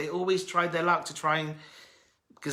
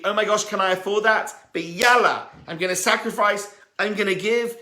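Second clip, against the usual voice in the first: louder, higher fretful self-talk turning resolute; mock inner struggle; 6 semitones higher, 6 dB louder than usual. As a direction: gasp at the cost, then commit. Imagined giver talking himself into it.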